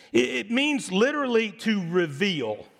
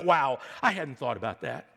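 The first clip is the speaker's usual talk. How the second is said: dry sarcastic wow, dropped low; deadpan mock gratitude; low, flat-out sarcastic aside; 8 semitones lower than usual. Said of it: "and this is very sarcastic".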